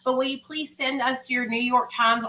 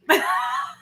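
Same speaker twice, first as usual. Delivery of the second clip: breathy laugh. Real, not acted. Laughs at her costume idea.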